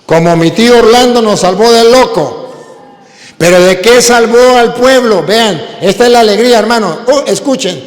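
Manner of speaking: loud, slow, high proclaiming; impassioned pulpit delivery; preaching at full force. Acted. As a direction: drive the verse home to the hall.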